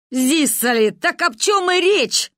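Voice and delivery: high, emphatic, drawn-out retort; exclaiming grandma. A narrator's reading. Grandmother scoffing at father's argument, exclaiming indignantly. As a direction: throw it back, indignant and high.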